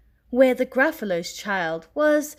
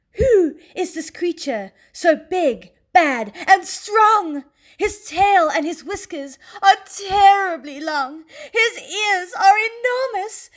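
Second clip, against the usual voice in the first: narration rising higher and louder with a gasp; building suspense; 9 semitones higher, 6 dB louder than usual. A narrator's reading. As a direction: build the dread toward the reveal. Terrible shadow appears.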